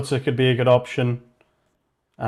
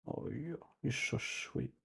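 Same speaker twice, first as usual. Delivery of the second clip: much softer cooing to a pet; soft coo to cat; 16 dB quieter, slower than usual. Real, not acted. A man murmuring fondly to his cat, very soft. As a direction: murmur it close, sweetly to the cat.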